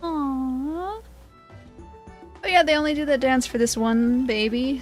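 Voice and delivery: ordinary talk, nothing marked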